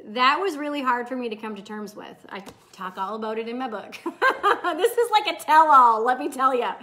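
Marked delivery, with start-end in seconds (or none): 2.3-6.7 bursts of real laughter through the words; laughing. Real, not acted. Coach admits her book tells all, laughing. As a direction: say it cracking up.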